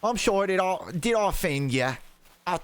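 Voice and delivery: high-pitched, muddled British accent; hammed-up mock British, several accents at once; bad British accent. Shown as a demonstration. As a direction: do a badly faked British accent that wanders. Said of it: "I don't know how to do a British accent".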